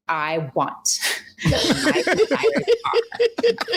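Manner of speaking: slow, high laughing mock demand. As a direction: parody her own demanding self while laughing. Mocking her own demand.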